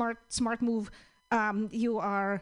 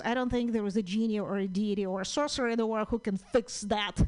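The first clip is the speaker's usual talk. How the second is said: normal talking voice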